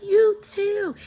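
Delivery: high, loud singsong return wish; excited farewell. Retold or imitated. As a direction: call it back loud and very high. Calls back a happy farewell.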